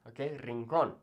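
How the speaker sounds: usual speaking voice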